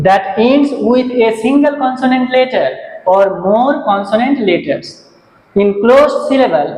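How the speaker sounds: slow, careful definition read; teacher repeating the definition, slow and clear; slow dictation. Shown as a demonstration. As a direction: spell it out slowly for the class.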